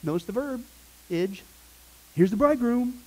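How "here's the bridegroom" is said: high, urgent call to come out; raised, excited acted announcement; ringing cry. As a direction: call out the news to sleepers. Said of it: "the cry rang out".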